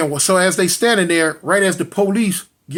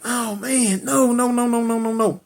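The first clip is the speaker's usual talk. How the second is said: higher-pitched mild wail of denial; retold crying; 6 semitones higher than usual. Brother cradling his shot sister, acted-out tearful pleading. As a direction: imitate a brother's grief, pitch lifted. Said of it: "and he crying".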